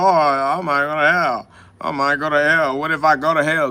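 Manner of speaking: imitating worried cowards, lightly put on; mock their fretting about it; mock fearful. Mimicking viewers afraid of hell, mock fearful. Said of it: "That's why they're afraid to go to hell".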